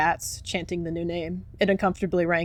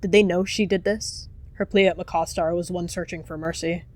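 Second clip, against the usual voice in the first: usual speaking voice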